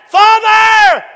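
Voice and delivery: high, loud cry of "Father"; shouted. Acted. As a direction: call out to God from the cross. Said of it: "He shouted".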